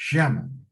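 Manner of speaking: "Llámame" said "shamame". Shown as a demonstration. Teacher demoing substitution to class: "Llámame" as "shamame". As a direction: start "Llámame" with a sh sound. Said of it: "está cambiando la doble L por la Y".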